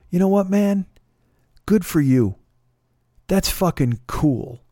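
impressed stranger. Retold or imitated. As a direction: play the guy won over, a bit awed.

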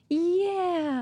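high, falling drawn-out "yeah"; mock self-esteem reaction. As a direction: let it slide down from high pitch. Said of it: "makes my self-esteem go".

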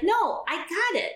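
mock the husband's easy dismissal; dismissive husband. Husband brushing her off again.

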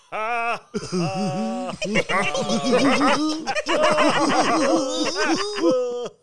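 high shrieking courtier laughs; chorused laughter. A narrator's reading. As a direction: cackle along with the king. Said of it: "his laugh was chorused by the seven".

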